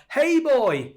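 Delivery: high called-out Manx greeting; calling out Manx hey boy; Manx call across the bar. Retold or imitated. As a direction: hail a mate across a bar. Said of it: "That's another Manx thing".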